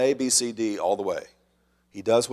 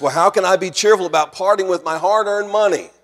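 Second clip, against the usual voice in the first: higher, louder mock protest; protesting objection; 8 semitones higher, 7 dB louder than usual. A reluctant giver questions the preacher, protesting loudly. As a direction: push it out like an indignant objector.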